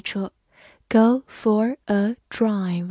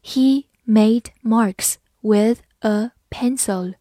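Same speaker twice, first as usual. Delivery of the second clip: slow, evenly spaced words; slow careful reading; slower than usual. Shown as a demonstration. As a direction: read slowly, a pause after each word. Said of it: "好，慢慢来读".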